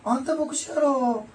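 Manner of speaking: high, loud teasing Osaka-ben, slowed; a mother ribbing her son in Osaka dialect. Retold or imitated. Mother teasing her pastor son, loud high Osaka-ben. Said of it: "大阪弁で".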